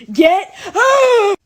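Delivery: very high, full-volume yell; exaggerated high shout of indignation; mock furious shout. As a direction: scream it in hammed-up outrage. Mock fury at the classmates.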